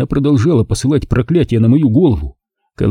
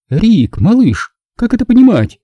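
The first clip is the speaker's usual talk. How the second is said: tense question; 12 semitones higher, 3 dB louder than usual. A narrator's reading. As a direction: ask it tight, holding the anger down. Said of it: "напряжённо спросила она".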